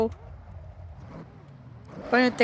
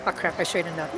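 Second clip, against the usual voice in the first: ordinary talk, nothing marked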